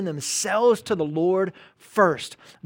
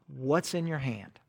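much softer and slow, gently probing; gentle question; 4 semitones lower, 9 dB quieter, slower than usual. Acted. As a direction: ask it quietly, like God searching Moses. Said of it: "Exodus four two".

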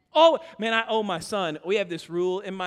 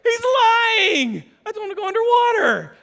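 much higher, louder, shouted disbelief; incredulous high voice; 13 semitones higher, 10 dB louder than usual. Retold or imitated. Dad protesting to his wife, shrill shouted disbelief. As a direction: squeal it in outraged disbelief. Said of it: "I'm like".

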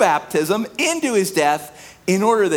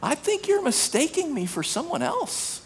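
soft polite dismissal; 6 semitones higher, 7 dB quieter than usual. Acted. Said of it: "Our response to sin is".

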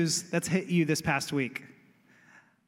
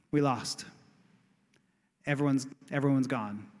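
low, dejected report of defeat; defeated messenger; 7 semitones lower, 3 dB quieter than usual. Retold or imitated. Runner tells the home base they lost, in a low defeated voice. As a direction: deliver the loss like a beaten runner.

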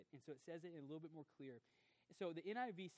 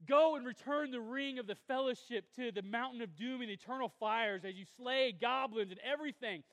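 loud, high, driving sermon delivery; preaching at full force; 7 semitones higher, 12 dB louder than usual. Acted. Pastor to his congregation, preaching at full force. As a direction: preach it out to the whole room.

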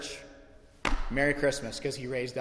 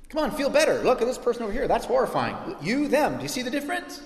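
higher, chirpy brush-off, mocking; mock pep talk; 7 semitones higher than usual. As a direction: cheerfully dismiss their pain.

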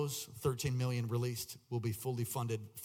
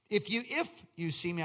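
"If" hit hard and repeated, pitch raised; higher, emphatic "If"; stress on "If"; 7 semitones higher than usual. Shown as a demonstration. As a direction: lean hard on the word "If". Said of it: "I read it with emphasis".

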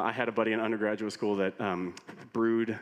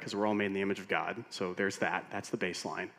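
ordinary delivery

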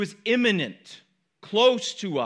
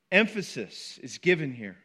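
ordinary delivery

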